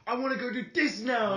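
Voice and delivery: eager excited. Retold or imitated. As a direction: burst out raring to go. Acting out eager skater self.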